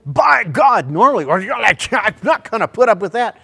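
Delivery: loud, high preaching shout; preacher shouting to his flock; full preaching. Acted. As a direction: preach it at full force to the congregation. Preacher building to full force.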